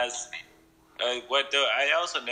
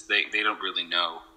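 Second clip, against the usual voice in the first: usual speaking voice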